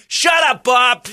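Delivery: high, loud, shrieking at her husband; mock shout. Wife yelling at husband, high mock shout. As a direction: yell it at him.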